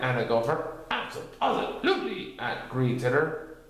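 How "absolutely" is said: high, bouncy tiger voice; chirpy, springy agreement; high Tigger voice. A narrator's reading. Tigger agreeing with his friends, high and bouncy.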